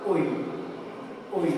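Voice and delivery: ওই said as a clear ɔɪ glide; diphthong demo. Shown as a demonstration. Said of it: "এই দুইটাই মিলে কি হয়?". (ডিফথং ওই স্পষ্ট করে)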